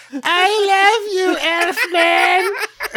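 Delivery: very high, slowly drawn-out squeal; hammed-up high-pitched declaration of love; imitated little girl. Girl calls to departing Elfman, hammed-up high adoration. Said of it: "And then she's like".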